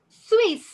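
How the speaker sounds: Swiss said high and loud, clipped; French Swiss. Shown as a demonstration. Teacher gives the French word for Swiss nationality. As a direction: pop Swiss out sharply and up high. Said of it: "Switzerland is Swiss".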